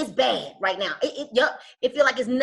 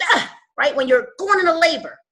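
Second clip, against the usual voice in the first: usual speaking voice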